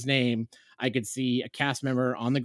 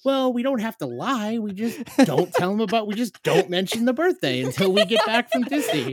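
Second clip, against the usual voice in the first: high, louder mock-innocent justifying; defensive wheedling; 9 semitones higher, 5 dB louder than usual. Dad justifying birthday fib to wife, defensive. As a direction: talk your way out of it, high-pitched.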